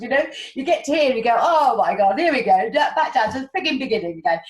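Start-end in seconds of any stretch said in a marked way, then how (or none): 1.4-4.4 loud, exasperated mock outburst; exasperated groan. Player back at the beginning voices loud mock exasperation. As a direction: throw your hands up at starting over.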